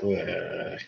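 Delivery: soft, empty brain-dead groan; dazed groan. Retold or imitated. Stapled villains left brain dead, dazed groan. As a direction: groan like your mind is gone.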